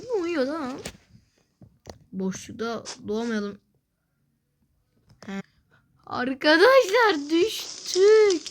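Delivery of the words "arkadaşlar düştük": loud, high, drawn-out cry of dismay; dismayed yell. Real, not acted. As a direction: yell it slowly as you fall. Falling in the game.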